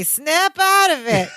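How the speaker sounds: hammed-up urgent Larry; pleading Larry.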